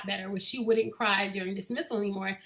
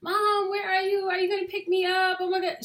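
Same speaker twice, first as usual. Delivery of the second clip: higher mock panicked little girl; higher retold frantic kid; imitating frantic child; 9 semitones higher than usual. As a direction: play a second grader panicking on the phone. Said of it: "calling her mom on the cell phone frantically".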